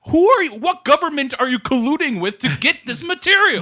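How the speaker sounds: high, loud mock outrage; incredulous shout. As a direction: demand it, indignant and loud. Mock outraged question to suppliers.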